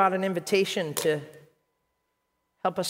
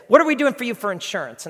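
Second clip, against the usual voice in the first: loud, raised mock questioning; gruff boss; 7 semitones higher, 9 dB louder, faster than usual. Boss asks employee about insurance, gruff. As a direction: imitate a brusque boss demanding an answer. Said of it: "he's just gruff".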